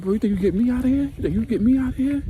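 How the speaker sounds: high, loud, insistent imitation; retold excited plea. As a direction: voice shoots up, pressing the question.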